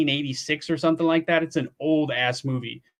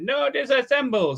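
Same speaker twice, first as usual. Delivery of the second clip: high-pitched robot impression; Johnny Five; 9 semitones higher than usual. Knife reviewer quoting a robot movie, high robot voice. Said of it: "quoting Johnny Five from the movie".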